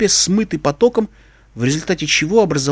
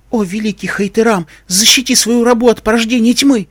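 frightened pleading prayer; 7 semitones higher, 4 dB louder than usual. A narrator's reading. Frightened girl praying to her god.

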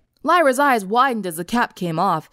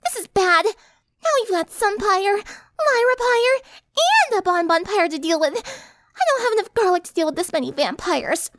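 put-on very high, girlish character voice; fretting Pinky; 12 semitones higher than usual. Pinky counting too many vampires to deal with, fretting. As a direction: worry aloud in a high squeaky voice.

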